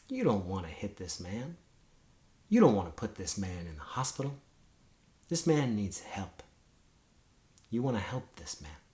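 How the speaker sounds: soft, low, calming counsel; peaceful inner voice. Acted. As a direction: gently talk yourself down from a fight. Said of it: "that part was saying".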